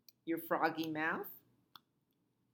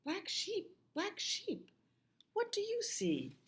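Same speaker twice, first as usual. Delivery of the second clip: much higher, softer lilting refrain; soft sing-song refrain; 3 dB quieter than usual. Acted. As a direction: croon the refrain gently to the kids.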